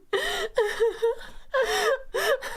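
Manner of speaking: loud, high cackling fit; hysterical laughter. Real, not acted. Player losing it at the game, hysterical laugh.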